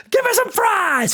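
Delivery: high-pitched screaming order; mock scream. Daphne slamming the menu down at the waiter, screaming. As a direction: shriek it like a diner losing it. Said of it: "slams the menu down and screams".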